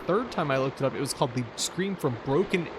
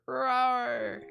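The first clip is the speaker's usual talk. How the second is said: kitten roar; 10 semitones higher than usual. Pretend. Playing the angry kitten.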